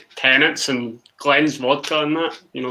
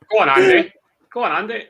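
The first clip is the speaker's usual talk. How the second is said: cheering; 9 semitones higher, faster than usual. Real, not acted. Cheering a Scotland attack.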